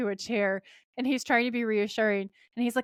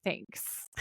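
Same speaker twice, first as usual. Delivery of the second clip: deadpan whisper; 11 dB quieter than usual. Retold or imitated. Sam's deadpan reply in retelling.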